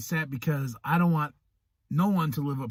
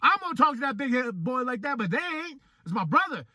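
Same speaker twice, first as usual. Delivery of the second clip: higher, mock-protective big brother; imitating Big Daddy; 11 semitones higher than usual. Quoting Big Daddy defending his little brother, mock outrage. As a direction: voice a fired-up brother defending him. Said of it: "he's like".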